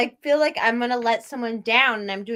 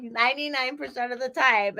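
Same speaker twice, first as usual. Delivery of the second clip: ordinary talk, nothing marked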